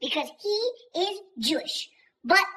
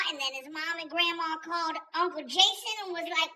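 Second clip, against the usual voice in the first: normal talking voice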